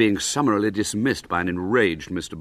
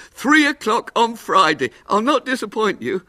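lightly voiced hopeful showman; eager little showman; 14 semitones higher, 7 dB louder than usual. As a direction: plead for the booking.

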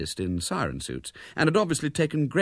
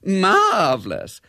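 high, loud, drawn-out "Marvellous"; gushing high-keyed "Marvellous"; on a higher key; 13 semitones higher, 8 dB louder, much slower than usual. A narrator's reading. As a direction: pitch "Marvellous" way up and stretch it. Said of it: "said Bella on a higher key".

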